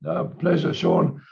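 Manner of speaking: hoarse, croaky throat. Real, not acted. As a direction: speak through a croaky, rough throat. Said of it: "Excuse my croaky voice".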